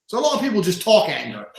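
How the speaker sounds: ordinary delivery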